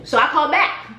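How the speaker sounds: ordinary talk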